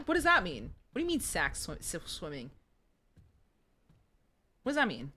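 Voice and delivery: usual speaking voice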